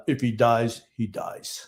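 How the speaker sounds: bad Drago-style Russian accent, trailing to a breathy finish; terrible Lou accent. Cohost does a bad Lou accent in a cold Russian mumble. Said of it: "my terrible Lou accent".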